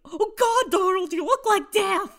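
loud, high cartoon scream; goofy full-voiced yell. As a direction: holler it in horrified cartoon shock. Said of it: "He's literally screaming".

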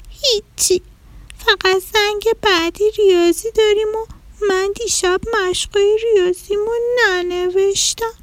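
put-on high, soft childlike rabbit voice; little rabbit. Little rabbit telling the mouse, high sad child voice.

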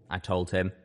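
ordinary delivery

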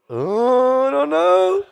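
high, loud falsetto tease; teasing sing-song. Pretend. Singer teasing the crowd about London, loud high-pitched coy voice. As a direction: squeal it coyly, drawn out.

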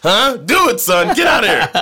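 high, loud laughing dad yelling; shouting father. Pretend. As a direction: yell it, half laughing.

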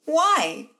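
put-on small wobbly child voice; trembling child's why. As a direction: ask why, near tears. Boy's lip wobbling, translated.

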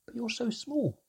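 very high, astonished voice; amazed protest. A narrator's reading. Narrator cannot believe mermaid is so small, amazed. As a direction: blurt it out high in disbelief.

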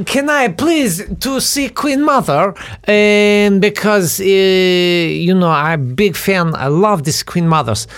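high, drawn-out mock Ukrainian voice; fake Ukrainian accent. Tipsy brother pleads with a policeman, fake Ukrainian accent. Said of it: "my brother, who was pretty hopped up on the Alco Pops".

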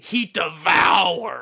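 loud, slow, drawn-out emphasis; emphatic shout. Real, not acted. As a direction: belt it with relish. Emphatic praise of actor.

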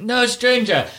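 high imitation of a child's shout; daughter's call. Daughter practising against strangers, imitated high child's call. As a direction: lightly mimic a small girl calling out. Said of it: "and she was like".

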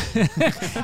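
loud, high burst of real laughter. Real, not acted. Host laughing at the trauma-comedy parody, real laughter. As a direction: crack up at the joke.

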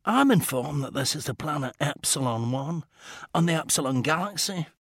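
quiet, dry and precise; a composed inventor gently scolding; calm Scottish gentleman. Acted. Mackenzie character dialogue.

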